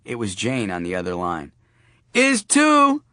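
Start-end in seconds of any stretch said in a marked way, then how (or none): 2.1-3.0 put-on high, bright little girl piping up; a young daughter chiming in. Daughter butting in on the phone call, little girl voice.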